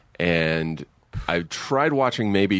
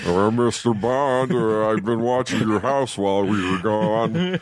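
put-on Foster Brooks drunk voice. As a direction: slur it like a drunk comic. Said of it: "we were just talking like that".

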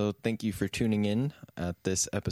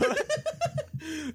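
high squeal laugh. Real, not acted. Cracking up.

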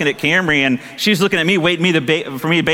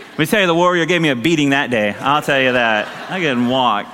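usual speaking voice

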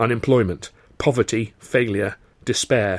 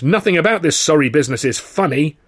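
higher, louder disapproval; stern boss, raised voice; indignant; 5 semitones higher, 4 dB louder than usual. Acted. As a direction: scold him with cold outrage. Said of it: "Sid exclaimed".

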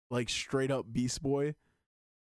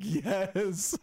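excited yes; 5 semitones higher than usual. Retold or imitated. Fan cheering on Beast Boy's transformation, excited. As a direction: blurt it out, thrilled.

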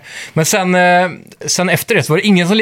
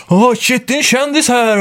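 high-pitched mock fan excitement; retold excited recognition, voice jumping high; imitating starstruck fans; 7 semitones higher than usual. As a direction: gush like an impressed fan, pitched up. Retold excited fans spotting a celebrity.

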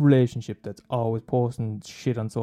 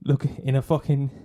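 usual speaking voice

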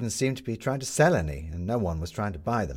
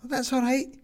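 put-on high child voice. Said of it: "said Midge".

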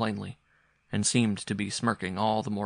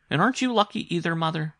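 put-on high, louder child's voice; questioning boy; 9 semitones higher, 6 dB louder than usual. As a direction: press Mother with a child's question. Boy questions his mother.

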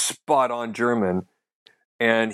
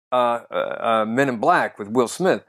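normal talking voice

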